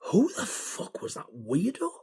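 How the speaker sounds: hushed, high-pitched bewilderment; baffled disbelief. Retold or imitated. Baffled reaction after strange caller.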